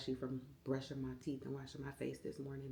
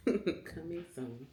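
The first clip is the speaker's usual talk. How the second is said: laughing teaser; 2 semitones higher, 4 dB louder, much slower than usual. Real, not acted. Laughing drawn-out repeat.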